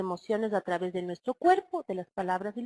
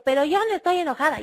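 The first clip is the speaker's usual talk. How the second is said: fast, louder and higher, denying anger while shouting; mock yelling mother; 5 semitones higher, 6 dB louder, faster than usual. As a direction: play the mother who yells she isn't angry. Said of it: "y comienza a gritar".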